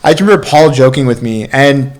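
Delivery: ordinary talk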